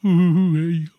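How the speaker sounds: loud, high-pitched mock of an Aussie greeting; mocking imitation. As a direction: take the piss with a hooting "ooh". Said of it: "they're gonna be taking the piss".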